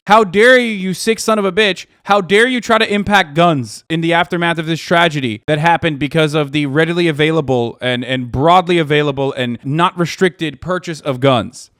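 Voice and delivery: loud, high mocking tirade; mock Republican rant. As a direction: spit it out as the parody of what they really mean. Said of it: "what they're actually saying is".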